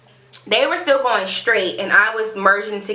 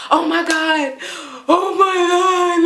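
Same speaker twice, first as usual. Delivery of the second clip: high re-enacted panic; drawn-out, high panicked exclamation, acted out; freaking out; 8 semitones higher, slower than usual. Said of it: "freaking out".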